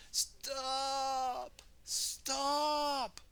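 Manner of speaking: put-on high, urgent old lady's call; Missus Potts crying stop. Missus Potts stopping Rolly, high urgent call.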